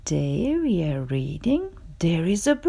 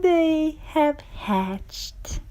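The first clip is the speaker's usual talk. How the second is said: soft wonder; 4 semitones higher, 7 dB quieter, much slower than usual. Acted. Piggie marvels softly.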